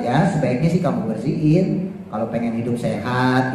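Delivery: put-on a parent softly suggesting the child clean up; soft suggestion. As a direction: ease it out as friendly advice, no pressure. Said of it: "kalau kita bahasanya".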